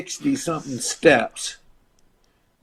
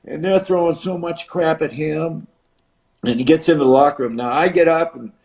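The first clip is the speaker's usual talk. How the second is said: ordinary delivery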